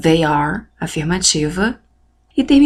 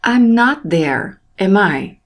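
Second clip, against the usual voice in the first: slow model English; slower than usual. Shown as a demonstration. Teacher modelling English example.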